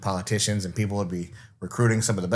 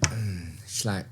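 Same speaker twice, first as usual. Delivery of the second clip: ordinary delivery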